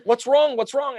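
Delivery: high, loud mom asking what's wrong; worried mom. Retold or imitated. Mom asking her crying son what's wrong, high and worried. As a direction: play the mother, high and worried. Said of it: "And she's like".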